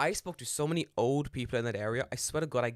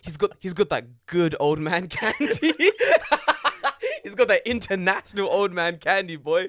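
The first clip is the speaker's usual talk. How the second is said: loud, high, breaking into laughter; real giggling hype, raised voice; excited laughing; 6 semitones higher, 9 dB louder than usual. Real, not acted. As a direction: crow it through big laughs. Cracking up over old man candy.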